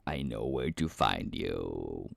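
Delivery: mock threat. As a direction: play the movie villain, teasing. Joking threat to a chat viewer.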